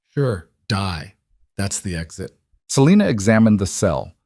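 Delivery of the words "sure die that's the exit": softer, bitter dark joke; tossed off with a broken bitterness, fading away. A narrator's reading. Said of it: "The women laughed, bitter, broken".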